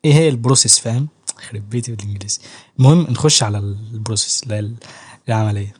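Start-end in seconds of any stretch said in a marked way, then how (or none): 1.5-2.4 a whispered joking aside. Real, not acted. A vlogger whispers a joke to viewers about the English word, whispered.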